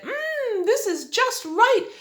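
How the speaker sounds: put-on high, loud delighted girl voice; pleased girl finding it perfect; delighted little girl. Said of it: "and said".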